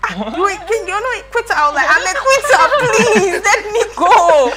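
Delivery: high, loud laughing protest; laughing plea. Retold or imitated. Camper begging to quit morning exercise, laughing high. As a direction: beg to quit through laughter.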